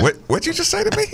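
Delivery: fast high-pitched what did you say; mock outrage. Real, not acted. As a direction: act scandalized by the insult. Feigned outrage at the remark.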